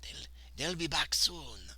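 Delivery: slow, lightly attempted Italian accent; a tentative go at an Italian accent. Trying a voice for the Italian worker, shaky Italian accent. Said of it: "he's got an Italian accent".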